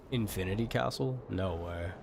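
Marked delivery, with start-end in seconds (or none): none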